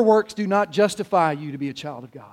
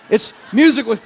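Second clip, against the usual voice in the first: ordinary talk, nothing marked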